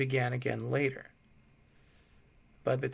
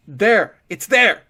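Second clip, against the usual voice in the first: high, very loud shriek of terror; terrified shriek; 8 semitones higher, 15 dB louder, slower than usual. A narrator's reading. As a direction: shriek as it appears. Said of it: "The sobbing prisoner shrieked".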